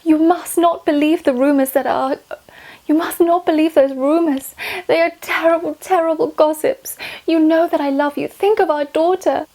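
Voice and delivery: high, loud pleading queen; Anne Boleyn. Acted. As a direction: beg Henry desperately, hammed-up. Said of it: "Am I, am I her?".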